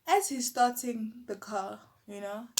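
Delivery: normal talking voice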